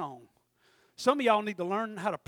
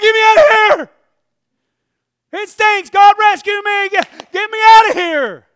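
much higher and louder, frantic begging; desperate pleading; 12 semitones higher, 24 dB louder than usual. Retold or imitated. Preacher in the oven begging God to get him out, frantic yell. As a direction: beg to be rescued. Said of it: "I'm like".

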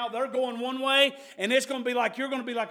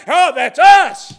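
mock; 7 semitones higher, 18 dB louder, much slower than usual. Preacher mocks boastful churchgoers.

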